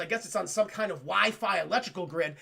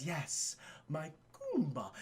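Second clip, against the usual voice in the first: hushed, low, savoring the word; quiet villain; 6 semitones lower, 10 dB quieter, slower than usual. Retold or imitated. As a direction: murmur it like a pet name. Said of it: "'cause he just says".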